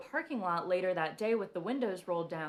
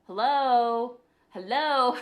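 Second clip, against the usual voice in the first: loud, higher man's voice repeating; man's voice echoing; 5 semitones higher, 10 dB louder, much slower than usual. Retold or imitated. A spirit guide answering her plea, loud and teasing. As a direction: call it out, louder each time. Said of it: "a man's voice going".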